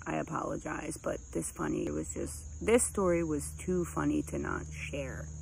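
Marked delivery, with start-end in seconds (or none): none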